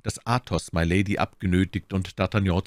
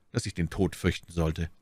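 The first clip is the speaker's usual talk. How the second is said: ordinary delivery, nothing marked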